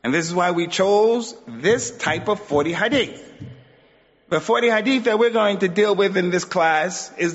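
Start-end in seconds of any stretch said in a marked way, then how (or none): none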